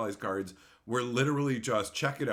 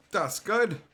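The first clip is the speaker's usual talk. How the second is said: higher, louder pleased "good"; firm approval; 9 semitones higher, 5 dB louder than usual. Real, not acted. Streamer showing viewers the fixed sign, pleased. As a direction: announce it proudly.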